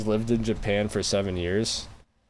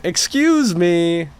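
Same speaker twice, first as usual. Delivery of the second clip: higher, louder "Excuse me" with a falling end; 8 semitones higher, 5 dB louder, much slower than usual. Pretend. Mocking how to get by in Japan, a loud polite call. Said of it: "really loud in every conversation".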